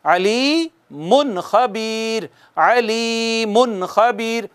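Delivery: अलीमुन खबीर very slowly, the नून just touched; slow correct recitation. Shown as a demonstration. Teacher modelling the correct reading. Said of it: "कैसे पढ़ेंगे?".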